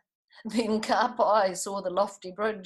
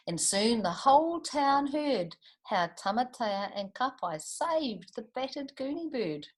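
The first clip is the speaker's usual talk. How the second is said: plain reading voice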